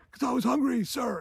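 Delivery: high, pleading put-on reply; imitating recruit. Recruit explains himself to the sergeant, mock pleading reply. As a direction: explain yourself as a mock cowed cadet.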